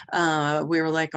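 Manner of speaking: usual speaking voice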